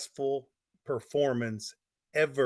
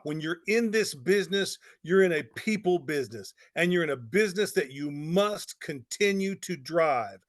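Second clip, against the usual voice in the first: normal talking voice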